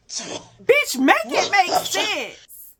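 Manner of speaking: high, slow disbelief; exasperated. Real, not acted. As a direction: stretch it out, fed up and baffled. Exasperated rhetorical outburst.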